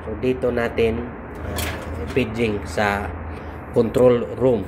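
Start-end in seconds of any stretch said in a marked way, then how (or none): none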